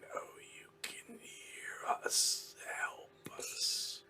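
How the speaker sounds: faint, ghostly whispered plea; haunting whisper from unseen captives; eerie whispering voice. Acted. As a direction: plead in a drawn-out, breath-only whisper. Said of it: "it's those two voices".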